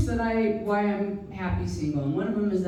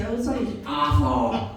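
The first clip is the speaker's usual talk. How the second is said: dropped low disgust; 8 semitones lower, faster than usual. Acted. Comic triple, drops low.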